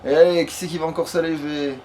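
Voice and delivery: usual speaking voice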